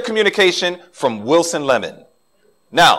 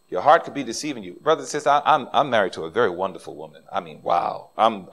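usual speaking voice

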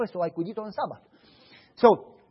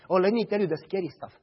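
ordinary talk

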